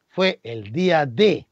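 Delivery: "fue el día D" slowed right down, "D" held; dramatic pause. Real, not acted. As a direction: draw out "el día D" for suspense. Dramatic reveal of the date.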